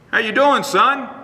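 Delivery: a cheery father checking on his son; lightly voiced dad; dad's greeting. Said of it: "he'd say".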